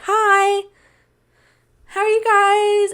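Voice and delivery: high, drawn-out sing-song hello; put-on friendly greeting. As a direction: stretch a fake-cheery hello up high. Retold forced greeting to acquaintances.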